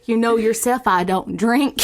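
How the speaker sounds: hammed-up Southern witness; Southern accent. Improvised witness telling of being paralyzed, Southern accent. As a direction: play a rattled Southern witness. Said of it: "If you like our Southern accent".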